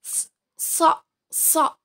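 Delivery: teacher's isolated demo of a heavy "so"; emphatic Arabic shad. Shown as a demonstration. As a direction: draw out the S, then a short heavy "so". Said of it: "sedikit naik ke atas".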